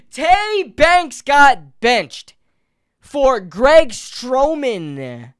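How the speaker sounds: a fan hearing who got benched, slowly stretched; stretched-out disbelief. Real, not acted. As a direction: drag every word out in disbelief.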